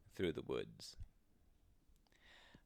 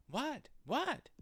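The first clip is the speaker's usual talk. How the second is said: high, squeaky eager questions; eager little rabbits. Acted. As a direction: ask twice, very high and keen. Rabbits eagerly ask the squirrel.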